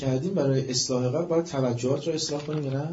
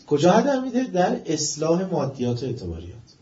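loud, raised preaching that keeps building; fiery preaching; 3 semitones higher, 8 dB louder than usual. Acted. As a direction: hammer the point home with rising force. Sermon rising to loud climax.

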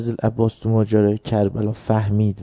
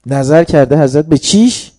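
louder, raised question to the crowd; rising preaching cry; 5 semitones higher, 11 dB louder than usual. Real, not acted. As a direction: call it out to the congregation.

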